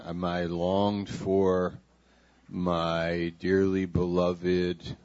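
slow, tender endearment. Shown as a demonstration. Pastor reads tenderly to the congregation, slow and warm. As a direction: savour each loving word slowly. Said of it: "five words for endearment".

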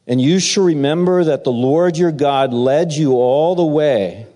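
ordinary delivery

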